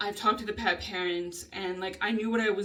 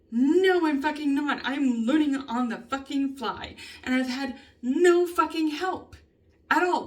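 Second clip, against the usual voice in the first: higher, real angry outburst; exasperated venting; 5 semitones higher, 4 dB louder than usual. Real, not acted. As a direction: push it up high, fed up and defensive. Venting frustration about work.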